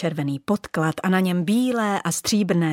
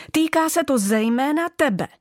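a touch of a sharp snap, higher; snapping; 7 semitones higher than usual. Acted. Old bauble snapping at the nearest new bauble. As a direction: snap at the little one. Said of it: "obořila se na ni".

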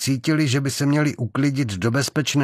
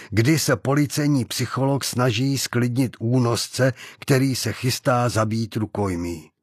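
usual speaking voice

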